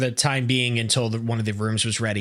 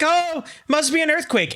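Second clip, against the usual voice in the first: high mock alarm; mock moms. Moms feeling the ball pit tremble, mock alarm. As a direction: ham up the startled mom. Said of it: "They're like".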